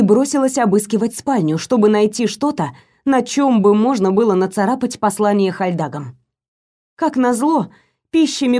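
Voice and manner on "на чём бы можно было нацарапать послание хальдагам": ordinary delivery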